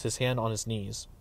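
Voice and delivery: usual speaking voice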